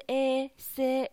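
에 then 세, drawn out slowly and built sound by sound. Shown as a demonstration. Teacher to students, slowly blending 세. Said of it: "第二个字读作스".

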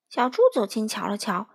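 ordinary delivery

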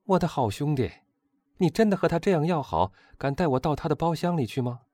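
high, eager coaxing of a friend; eager pleading. Acted. Young man begging his friend for an introduction, eager and high.

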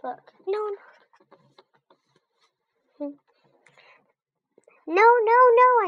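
very high, loud hamster squeaks; squeaking. A narrator's reading. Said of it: "I squeaked".

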